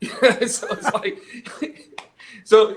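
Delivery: laughing. Real, not acted. Laughing at the Jesse Jackson point.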